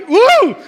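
high, loud "woo"; pumped-up whoop. Retold or imitated. Husband pumped by his wife's praise, loud proud whoop. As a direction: let out a big hyped-up whoop. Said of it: "I got really, like".

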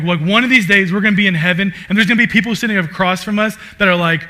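usual speaking voice

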